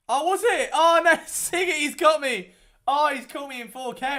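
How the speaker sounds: loud, high, laughing exclamation; excited laughing. Real, not acted. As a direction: caught out and cracking up. Caught yawning on stream, laughing.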